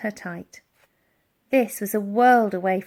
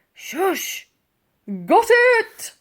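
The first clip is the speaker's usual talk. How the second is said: high, loud silly voice; a bumbling bobby; dim constable; 11 semitones higher, 6 dB louder, much slower than usual. A narrator's reading. Constable answering Barker, high loud.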